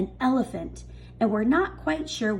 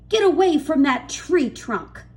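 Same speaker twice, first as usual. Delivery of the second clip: loud, high scolding yell; angry yell; 5 semitones higher, 7 dB louder than usual. A narrator's reading. Aunt Spiker yelling at James to get away, angry yell. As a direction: bark the boy away from the trunk. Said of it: "Aunt Spiker yelled".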